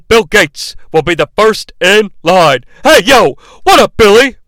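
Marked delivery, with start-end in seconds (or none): 2.8-4.4 louder, higher greeting call; mock yell. Imitating Shane yelling at Billy Gunn. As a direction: shout it like a hyped announcer. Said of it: "As he yells".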